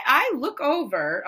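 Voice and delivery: ordinary delivery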